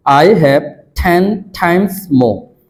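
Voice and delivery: very slow, careful drill reading. Shown as a demonstration. Teacher slowly modelling a drill for students. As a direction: spell it out slowly for the class. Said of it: "এক নিঃশ্বাসে দশ বারের মতো করে বলার".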